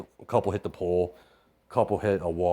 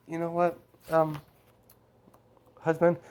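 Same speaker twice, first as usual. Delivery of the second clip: higher, lightly voiced mother; a light mom voice, pitched up; mother's voice; 9 semitones higher than usual. Mother turning to her husband, lightly voiced higher mom.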